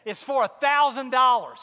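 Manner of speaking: wife breaking the big news, thrilled and loud; thrilled announcement. Retold or imitated. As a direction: blurt out the amount.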